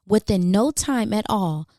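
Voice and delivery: plain reading voice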